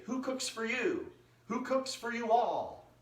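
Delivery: high, slow hooting of the words; sung-out owl hoot, high and drawn out; owl call in words. Retold or imitated. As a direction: hoot it like a barred owl calling. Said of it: "they say".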